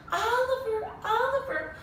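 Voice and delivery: high calling voice; mother calling out. Acted. Mother calling her lost dog Oliver, high call. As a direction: call the dog's name from afar, high. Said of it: "his mother calling out to him".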